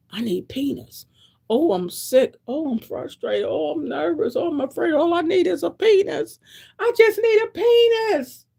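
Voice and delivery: climbing into a squeaky needy whine; mocking her younger self. To the audience, mimicking her younger self's whiny plea. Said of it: "I thought penis was everything".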